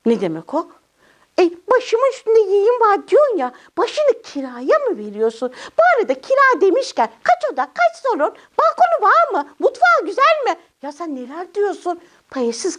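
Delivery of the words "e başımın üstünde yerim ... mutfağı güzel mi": high, chattering little character voice; high puppet voice, eagerly quizzing; cheeky puppet child. Acted. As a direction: fire off nosy questions as Cimcime.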